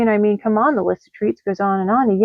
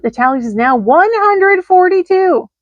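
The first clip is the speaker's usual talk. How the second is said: accusing tally; 8 semitones higher, 4 dB louder than usual. Acted. Angry cookie-debt letter.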